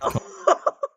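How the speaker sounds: high, loud burst of laughing; real loud laugh; burst of laughter. Real, not acted. Reacting to seagull covered in curry.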